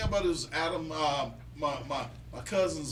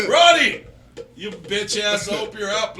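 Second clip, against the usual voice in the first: calling out; 8 dB louder, slower than usual. Real, not acted. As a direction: shout his name across the room. Calling out to absent friend.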